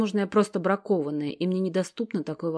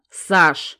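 plain reading voice

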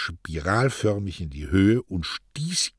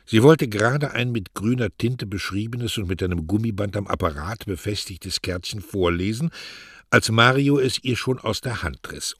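plain reading voice

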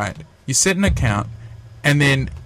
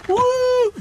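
very high whoop; mock whoop. Friend heading to the pub, a high mock whoop. Mock cheer ending quote.

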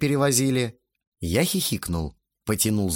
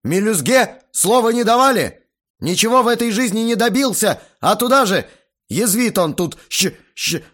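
loud, high tirade breaking into stutter; furious rant; 11 semitones higher, 6 dB louder than usual. A narrator's reading. Guest belittles the teenager, furious and sputtering. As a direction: rage on, then stumble on the insult.

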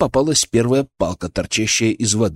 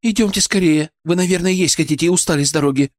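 quick, higher-pitched hostess; busy, rapid, lightly raised voice; fussing, bustling; 6 semitones higher, faster than usual. A narrator's reading. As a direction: hurry the guests along. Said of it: "засуетилась Аглая".

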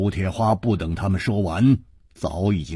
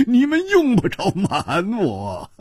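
hearty laughter breaking up the lines; laughing loudly; 9 semitones higher than usual. A narrator's reading. Hu Tiehua teases his secretive old friends, laughing heartily. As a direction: laugh between phrases, pitch swinging high and dropping. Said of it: "胡铁花忽然大笑说".

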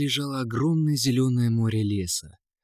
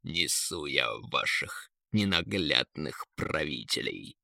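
low, slow, soft eagle; deep and unhurried, quietly sarcastic; eagle's voice; 8 semitones lower, 7 dB quieter than usual. A narrator's reading. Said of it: "остановил их Эрвин". (низко и медленно, голосом орла)

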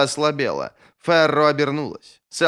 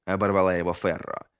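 usual speaking voice

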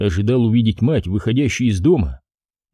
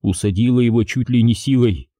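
ordinary delivery

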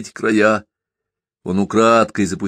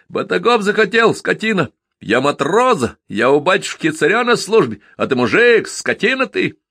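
shouting; 9 semitones higher than usual. A narrator's reading. Lad dodging round the sledge at the driver, shouting insults. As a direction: keep yelling insults while dodging. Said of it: "всё кричал".

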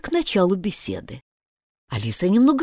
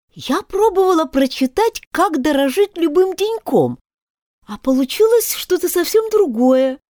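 wistful; 10 semitones higher, 4 dB louder than usual. A narrator's reading. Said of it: "сказала с тоской Алиса".

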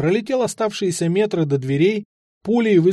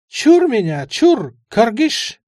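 put-on higher, louder frightened old man's cry; old man shouting after; 8 semitones higher, 6 dB louder, slower than usual. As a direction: shout a curse after the boy. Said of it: "неслось мне вслед".

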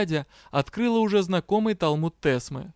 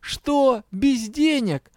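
high, stretched, appalled question; shocked disbelief; 8 semitones higher, much slower than usual. A narrator's reading. Partner learns she works without money, aghast. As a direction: draw it out in disbelief.

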